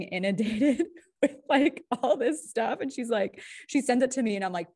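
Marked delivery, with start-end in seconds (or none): 0.0-3.4 laughing. Real, not acted. Co-host telling how her friend got inundated, laughing. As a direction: let a real laugh lift the voice higher. Amused at friend's new worry.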